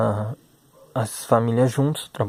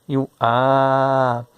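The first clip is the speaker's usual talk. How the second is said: slow, drawn-out sounding of each letter; teacher drawing out letter sounds; stretched syllable demo; 3 dB louder, much slower than usual. Shown as a demonstration. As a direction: sound out the letters slowly for a child. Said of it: "Se eu pegar e falar elas".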